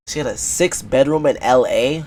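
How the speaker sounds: loud, incredulous question rising at the end; loud disbelief. Real, not acted. Doubting the guest's house out loud, loud disbelief. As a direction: shout it back as if it can't be true.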